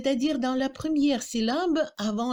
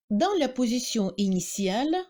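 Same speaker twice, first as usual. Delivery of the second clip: normal talking voice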